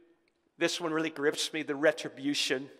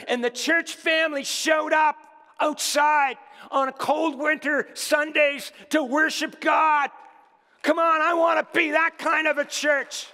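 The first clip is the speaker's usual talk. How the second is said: high, driving pulpit voice building to a shout; raised preacher's voice, swelling louder and higher; impassioned preaching; 6 semitones higher, 4 dB louder than usual. Real, not acted. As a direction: preach it hard, rallying the church. Preaching to congregation at full force.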